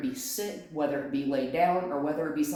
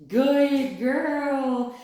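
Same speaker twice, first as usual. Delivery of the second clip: higher, louder praise; excited praise; 7 semitones higher, 7 dB louder than usual. Real, not acted. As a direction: lift it up for the dog.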